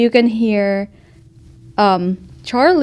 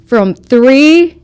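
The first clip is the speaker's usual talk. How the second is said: loud, drawn-out warning count; threatening countdown; 3 semitones higher, 8 dB louder, slower than usual. Acted. Game master as furious woman threatening to smash furniture, loud countdown. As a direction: count down loudly as an ultimatum.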